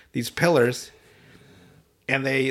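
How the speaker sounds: ordinary delivery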